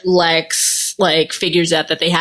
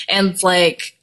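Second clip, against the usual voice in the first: usual speaking voice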